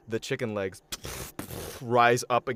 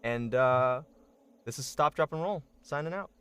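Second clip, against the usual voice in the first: stream outro; 7 semitones higher, 5 dB louder, faster than usual. Real, not acted.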